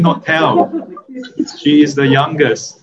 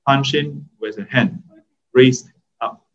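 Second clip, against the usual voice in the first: ordinary talk, nothing marked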